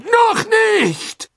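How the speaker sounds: high, loud cry of despair; desperate shout. A narrator's reading. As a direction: scream it out in desperation. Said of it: "schrie Karkaroff in heller Verzweiflung".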